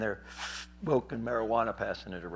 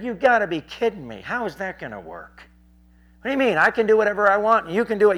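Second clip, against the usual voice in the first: loud, raised-pitch retort; incredulous arguing; 8 semitones higher, 9 dB louder than usual. Retold or imitated. Preacher argues back at the heckler, loud and incredulous.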